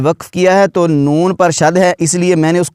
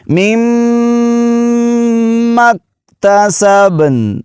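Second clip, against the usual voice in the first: slow, high, melodic tilawat; Quran recitation; 7 semitones higher, much slower than usual. Shown as a demonstration. Teacher reciting the passage for students, slow melodic chant. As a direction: chant the verse in long drawn melody. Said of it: "اس طرح وقف کریں گے".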